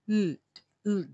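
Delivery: ordinary delivery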